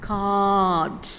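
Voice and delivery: "കാർഡ്" slowly as kaad, long ah, no R; card without R. Shown as a demonstration. Teacher modelling card to the class: "കാർഡ്" slow, as kaad. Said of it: "ആ sound pronounce ചെയ്യില്ല".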